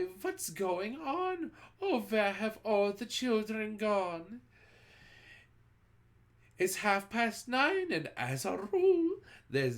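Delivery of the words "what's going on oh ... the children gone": high, slow bewildered cry; teachers crying out. A narrator's reading. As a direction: cry out in dismay. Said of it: "The teachers cry".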